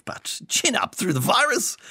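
high, jaunty Boris voice; breezy upbeat politician cheer; Boris impression. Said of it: "as they say".

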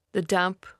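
usual speaking voice

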